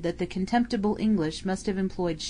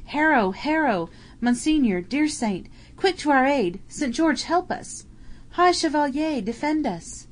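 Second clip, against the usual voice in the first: pleading cry; 7 semitones higher than usual. Acted. Medieval voices crying to Saint George for aid. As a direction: cry out for rescue from the battle. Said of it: "the Latin-bred soldier heard the cry".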